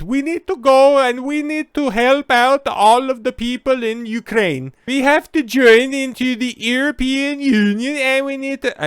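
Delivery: high, loud, shrill mock plea; mocking a politician. Mocking the Finnish prime minister, high and loud. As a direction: squeal it like a frantic leader. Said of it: "a fucking nutcase in charge that".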